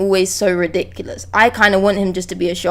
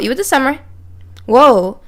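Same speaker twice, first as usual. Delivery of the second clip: ordinary talk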